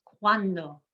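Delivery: "cuando" stressed on CUAN, falling on "do"; second-to-last stress. Shown as a demonstration. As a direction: lean on the first syllable of "cuando". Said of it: "We emphasize the second to last".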